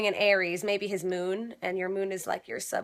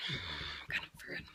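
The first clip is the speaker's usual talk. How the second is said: muttered resignation; 15 dB quieter than usual. Retold or imitated.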